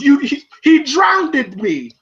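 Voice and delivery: slow high shout. Retold or imitated. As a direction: yell it out slowly. Voicing Paulie shouting.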